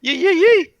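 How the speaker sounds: high, loud yee yee; excited yelps. Real, not acted. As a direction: whoop it out high. Excited outburst.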